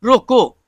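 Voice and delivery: "रोको" loud and on its own; a standalone command "रोको", followed by a stop; रोको read separately. Shown as a demonstration. Teacher splitting the phrase for students, "रोको" loud and separate. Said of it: "को अलग पढ़ा".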